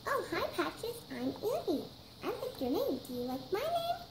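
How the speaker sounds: high, lilting toy-puppy voice; friendly pretend puppy. Puppy Annie meets kitten Patches, high friendly play voice.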